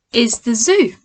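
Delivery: plain reading voice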